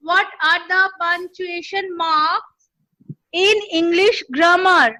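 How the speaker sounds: each word drawn out for writing; slow dictation of a question. Shown as a demonstration. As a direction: read the question very slowly. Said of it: "আমি আস্তে আস্তে dictate করছি".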